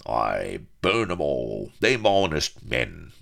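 loud, rustic sea-dog bawl; boisterous roar of approval; bawling pirate. A narrator's reading. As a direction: bawl it out like a rowdy buccaneer. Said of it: "bawled Firebeard".

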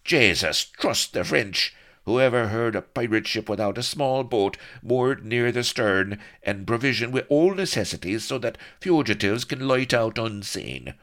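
loud, high exasperated rant; loud high fed-up tirade. A narrator's reading. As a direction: rant at the useless French. Blood swearing at the French.

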